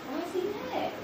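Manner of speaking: high, very soft question; puzzled. Retold or imitated. Teacher asking about upset student, puzzled. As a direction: ask it small and hushed.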